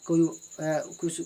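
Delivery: ordinary talk, nothing marked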